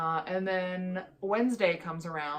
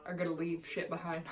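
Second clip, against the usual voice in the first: usual speaking voice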